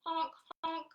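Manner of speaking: high, loud goose honk; playful goose honks. Acted. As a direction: honk it out for the kids. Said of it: "He honked".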